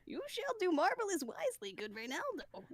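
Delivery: put-on an old man voice that comes out too high; a wobbly first try at an old man; failed old man voice. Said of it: "that's not an old man".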